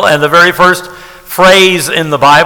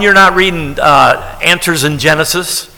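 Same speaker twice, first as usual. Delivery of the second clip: ordinary delivery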